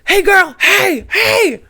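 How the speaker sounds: hollering across the kitchen for attention; yelled call. Retold or imitated. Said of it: "this guy yells from the kitchen".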